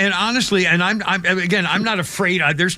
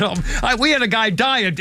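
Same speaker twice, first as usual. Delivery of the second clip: ordinary talk, nothing marked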